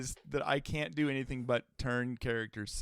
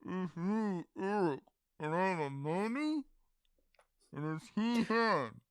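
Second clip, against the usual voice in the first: softer, higher mumbled mummy voice; muffled mummy; 5 semitones higher, 4 dB quieter than usual. Acted. Mummy co-host introducing himself, muffled through the wrappings. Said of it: "I'm gonna pull off the wrappings".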